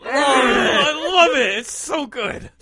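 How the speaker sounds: strained high words through clenched teeth; through gritted teeth. Retold or imitated. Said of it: "He's gonna just talk through his teeth".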